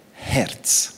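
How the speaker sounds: ordinary talk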